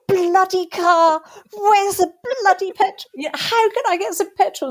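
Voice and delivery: high-pitched comic English ranting; John Cleese. Retold or imitated. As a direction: rant in exasperation, pitched well up. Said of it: "a bit John Cleese-like".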